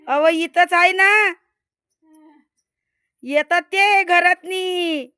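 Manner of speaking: high, loud call across the yard; anxious calling out. Real, not acted. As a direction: shout to Bunty's father that the snake is here. Calling husband about the snake. (घाबरून नवऱ्याला मोठ्याने हाक मारणे)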